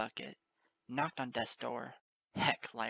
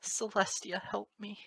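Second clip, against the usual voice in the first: higher, pleading through tears; tearful plea; 6 semitones higher than usual. Acted. Moon Dancer sobbing into Lyra's shoulder, tearful plea. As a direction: beg through the crying.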